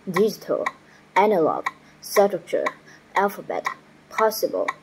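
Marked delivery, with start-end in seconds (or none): none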